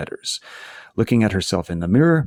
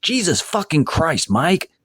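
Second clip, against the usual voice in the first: pained angry yell; 8 semitones higher than usual. A narrator's reading. Sarah tripped over her uncle's wire, yelling in pain. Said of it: "she yells".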